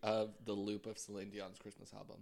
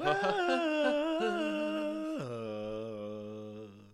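high squealing laugh; 6 dB louder than usual. Real, not acted. Laughs after sultry sign-off.

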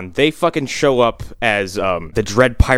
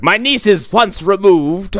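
mock evil monologue; 9 semitones higher than usual. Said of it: "in an evil monologue".

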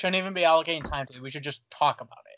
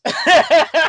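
loud laugh; 12 semitones higher, 14 dB louder than usual. Real, not acted. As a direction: burst into a loud, high laugh. Laughs at the question.

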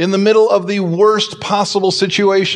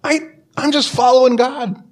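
helpless Moses; 7 semitones higher than usual. Acted. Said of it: "he's going".

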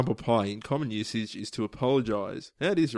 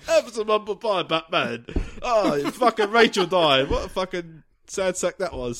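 high hammed-up Cockney butler; comic London butler, pitched well up; Michael Caine; 6 semitones higher, 3 dB louder than usual. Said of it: "I'm Michael Caine".

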